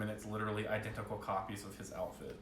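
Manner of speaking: ordinary talk, nothing marked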